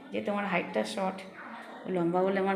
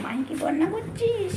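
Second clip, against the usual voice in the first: much higher, slow, drawn-out question; playful sing-song; 11 semitones higher, 4 dB louder, slower than usual. Real, not acted. Teasing the kids, asked twice. (উঁচু গলায় টেনে টেনে খেলার ছলে প্রশ্ন)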